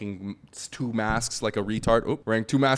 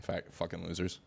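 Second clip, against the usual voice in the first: muttered insult; 4 semitones lower, 11 dB quieter than usual. Retold or imitated. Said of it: "I'm like".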